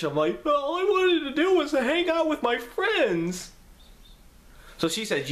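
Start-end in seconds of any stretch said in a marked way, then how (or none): 0.5-3.5 high slow wailing whine; imitating crying. Son crying to mom about friends, high slow wail. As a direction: stretch it out like a sobbing kid. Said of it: "crying like a little bitch".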